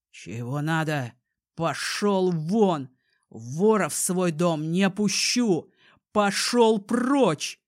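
put-on slow, loud angry old man. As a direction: bark him away from the door. Grandfather drives grandson away.

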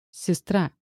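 mildly curt one-word reply; curt cut-off. A narrator's reading. Sister answering the guard, mildly curt. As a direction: cut him short, understated. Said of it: "отрезала она".

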